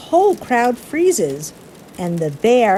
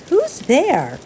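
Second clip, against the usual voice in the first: mouse cries out; 6 semitones higher than usual. Acted. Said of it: "Mouse cries".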